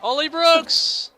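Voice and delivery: very high, loud, drawn-out yell; excited yell. Real, not acted. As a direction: shout the name in shock. Crash seen live.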